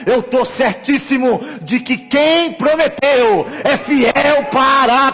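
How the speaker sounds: high, shouted sermon climax; slow the key words into a drawn-out shout; full-force preaching. Real, not acted. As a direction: proclaim it with total conviction, very loud.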